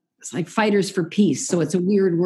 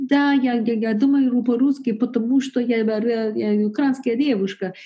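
mock Russian speaker; 3 semitones higher than usual. Showing how Portuguese sounds, a playful Russian voice. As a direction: voice a Ukrainian girl speaking Russian. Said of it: "almost sounds like it has".

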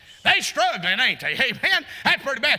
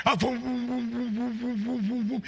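soft revving engine noises; engine vroom; 6 dB quieter than usual. Retold or imitated. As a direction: mimic cars revving up quietly. Imitating cars at the wall.

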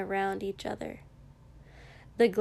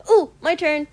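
eager; 7 semitones higher, 13 dB louder than usual. Acted. Eager friend jumping in.

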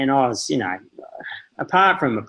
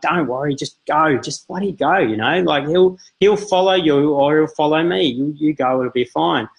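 higher-pitched, impatient reenactment; retold exasperated urging; 4 semitones higher than usual. Husband urging his wife to go on ahead, exasperated retelling. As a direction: wave off the worry, pressing the point.

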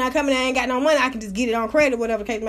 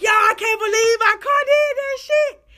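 amazed; 6 semitones higher than usual. Retold or imitated. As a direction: shout in disbelief at the car. Amazed after the jump.

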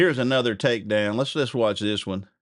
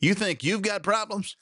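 wiener dog's comeback; 8 semitones higher than usual. Acted. Host reading a meme aloud, the wiener dog's high wry punchline. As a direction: deliver the punchline as the little dog, deadpan. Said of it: "the little dog says".

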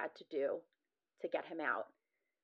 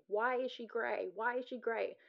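retold scream; 4 semitones higher than usual. Doctor alarmed at seeing the patient, muted retold scream. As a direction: imitate an alarmed scream without the volume. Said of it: "started screaming".

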